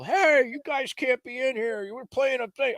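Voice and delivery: high-pitched imitation of a man hollering over; calling out a warning. Rider shouting a warning across hill.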